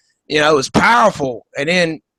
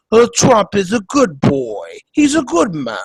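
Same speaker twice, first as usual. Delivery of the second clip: Melania Trump; 4 semitones higher than usual. Retold or imitated. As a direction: coo it in a hammed-up Slavic accent. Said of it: "It's because of her accent".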